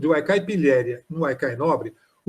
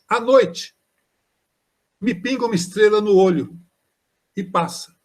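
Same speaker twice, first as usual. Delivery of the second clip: reciting a haiku; 3 semitones higher than usual. Shown as a demonstration. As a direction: let each line of the haiku hang in the air. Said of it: "Olha o haikai do Leminski".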